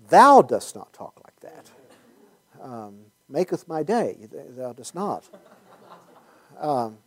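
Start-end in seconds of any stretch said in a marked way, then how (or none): 0.1-5.2 a hint of hammed-up archaic preacher; lightly mock the old Bible speech; mock King James English. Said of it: "You should learn to talk like that".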